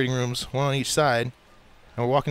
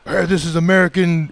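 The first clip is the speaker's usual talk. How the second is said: higher, louder excited exclamation; acted-out thrill; 4 semitones higher, 6 dB louder than usual. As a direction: proudly show it off, excited. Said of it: "they're really excited to tell me".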